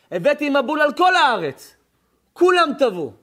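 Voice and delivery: loud, high proclamation as God; God declaring. Acted. God tells of the flood he brought, loud proclamation. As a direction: thunder out the divine verdict. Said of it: "אומר הקדוש ברוך הוא".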